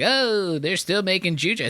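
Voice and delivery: high, whiny mock complaint. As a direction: whine it up high.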